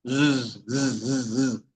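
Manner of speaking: repeated short buzzing "zzz"; bee buzz. Shown as a demonstration. Teacher recapping the third sound, repeated bee buzz. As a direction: buzz like a bee in separate bursts. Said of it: "el sonido de la abeja".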